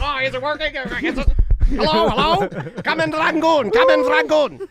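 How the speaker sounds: loud, high, crackly wartime radio call; old radio announcer. Retold or imitated. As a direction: call out like a WWII radio operator. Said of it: "Sound like an old propaganda radio in World War Two?".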